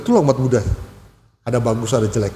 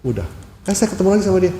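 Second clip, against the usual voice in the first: ordinary talk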